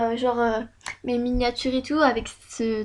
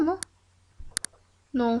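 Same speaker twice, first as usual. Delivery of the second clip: ordinary delivery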